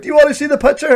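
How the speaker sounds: imitating a shout from the crowd, high pitch; retold heckler shout. Heckler calling out to the comedian on stage, mock shout. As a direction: call it out like a heckler, pitched well up. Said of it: "your man shouts out of the crowd".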